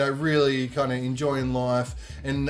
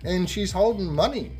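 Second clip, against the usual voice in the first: ordinary delivery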